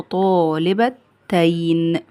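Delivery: very slow, stretched syllables, low; drawn-out dictation. Shown as a demonstration. Teacher showing pupils the dual form, drawn out. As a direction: spell it out by sound, slowly.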